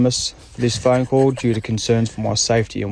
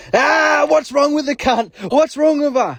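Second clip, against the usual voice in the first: high-pitched imitation of mate's yelling; Nugsy screaming down phone; 13 semitones higher, slower than usual. Mate blaring down the phone at the dinner table, imitated high-pitched screaming. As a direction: mimic a hysterical mate, pitched way up.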